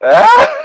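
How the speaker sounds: sharp, very high, loud yelp of dismay; startled yelp. Real, not acted. Friend hears the author's blessing, yelps high and loud. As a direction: recoil at the word blessings.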